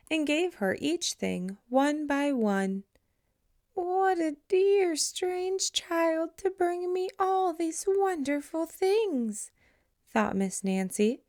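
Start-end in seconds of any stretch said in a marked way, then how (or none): 3.7-9.5 put-on high, slow old lady voice; slow and high like a frail old lady; old lady's voice. Old Miss Nancy thinking.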